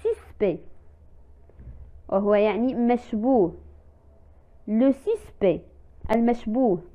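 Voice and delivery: usual speaking voice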